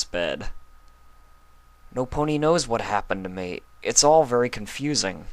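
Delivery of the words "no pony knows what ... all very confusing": put-on higher, bewildered young voice; Spike, confused young dragon. Spike waking in Luna's chamber, confused. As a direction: wake up lost and unsure.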